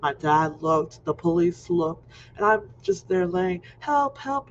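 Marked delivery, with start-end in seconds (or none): 3.8-4.5 higher, retold kid's cry; mock scream for help. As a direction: call out like a stuck kid. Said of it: "banging on the ground and screaming".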